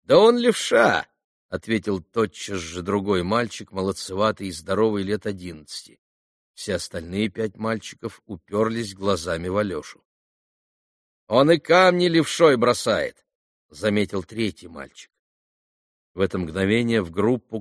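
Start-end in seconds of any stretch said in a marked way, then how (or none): 0.0-1.1 put-on high, loud, drawn-out child's shout; boy calling out. Boy calls out an answer. 11.3-13.2 put-on higher, louder boy's voice; boy chiming in. Third boy chimes in.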